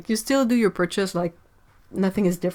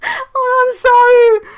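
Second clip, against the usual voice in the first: much higher and louder, sorry half-sobbed through laughter; laugh-crying apology; 14 semitones higher, 12 dB louder, much slower than usual. Real, not acted. Streamer apologising to chat, laughing to tears. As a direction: apologise while you can't stop laughing. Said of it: "I'm crying".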